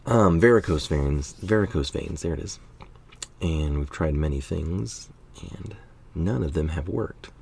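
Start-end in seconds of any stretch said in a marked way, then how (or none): 3.4-7.3 hushed, confiding whisper; whispered aside about the wife. Real, not acted. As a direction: drop to a near whisper, sharing something private. Confides wife's struggles, softly.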